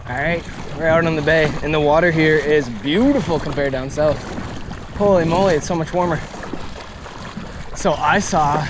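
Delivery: gusty wind over the mic; talking into strong wind. Real, not acted. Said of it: "pardon the wind noise".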